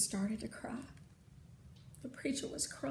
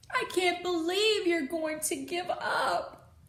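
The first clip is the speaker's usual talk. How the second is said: higher, louder, slow and hurt; upset protest; 7 semitones higher, 7 dB louder than usual. Acted. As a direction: throw his giving up back at him. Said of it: "I told him".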